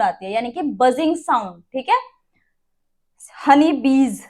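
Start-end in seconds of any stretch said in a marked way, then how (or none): 3.5-4.2 हनी बीज with a buzzing z at the end of बीज. Shown as a demonstration. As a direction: stretch the final z of बीज into a buzz. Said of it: "ज आया last में".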